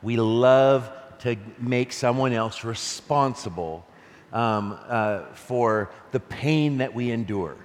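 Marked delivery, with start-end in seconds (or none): none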